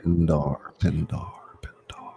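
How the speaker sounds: unvoiced whispered name. Whispering a cue name to the reader, whispered. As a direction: breathe the name twice, fading.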